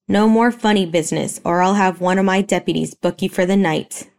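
usual speaking voice

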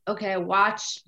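ordinary talk

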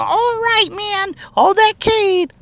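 very high, slow squeaky drawl; ghost's voice. Retold or imitated. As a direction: ham up the annoying robot. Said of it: "saying his voice that way".

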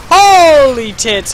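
slow, loud drawn-out shock; shocked exclamation. Real, not acted. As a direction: stretch it out in disbelief. Shocked in game.

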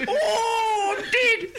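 very high, loud mock granny wail; grandma impression. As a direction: wail it like a hammed-up dying grandma. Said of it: "doing an impression of my gran".

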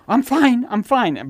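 put-on high child voice, insisting; high, brushing it off like a child; child insisting fine. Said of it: "And they go".

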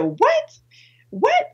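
re-enacted high squeals of disbelief; astonished. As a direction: squeak each "what?" as if stopped dead. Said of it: "I stopped, like, in the street".

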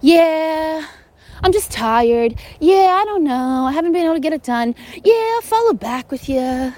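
high, careless mock voice with lazy yeahs; loosey-goosey talk. Mocking someone careless with their words, offhand mock whine. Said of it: "throwing words around like".

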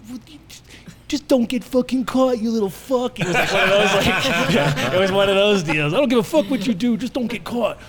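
high, loud furious rant; angry uncle yelling. Retold or imitated. Uncle grabbing teen by the hair, yelling at him. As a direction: chew the kid out hard. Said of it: "Grabs me by the".

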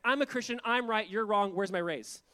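loud, smug imitation of entitled worker; smug attitude. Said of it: "this attitude of, like, smugness".

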